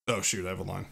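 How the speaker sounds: usual speaking voice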